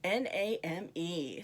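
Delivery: letter by letter, slow and clear; spelled out slowly. Shown as a demonstration. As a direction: spell each letter distinctly. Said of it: "the word name".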